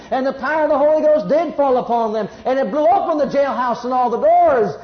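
high, loud revival preaching; preaching at full force. Acted. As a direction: build it up into a shouting climax. Preaching the jailhouse climax.

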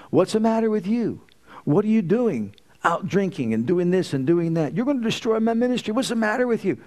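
high mocking imitation of his scolding; mimicking angry father. The Lord mimicking the father scolding his daughter. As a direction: parrot his own angry rant back at him. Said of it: "the Lord said".

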